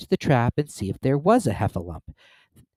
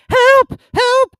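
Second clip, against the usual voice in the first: very high, loud panicked cry; Piglet; 12 dB louder than usual. A narrator's reading. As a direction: squeal for help in a tiny creature voice. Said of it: "cried Piglet".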